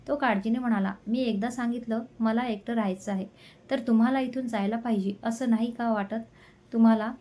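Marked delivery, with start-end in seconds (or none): none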